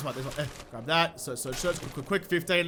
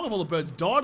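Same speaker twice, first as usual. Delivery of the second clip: ordinary talk, nothing marked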